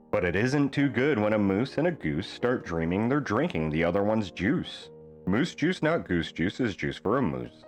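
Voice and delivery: ordinary delivery, nothing marked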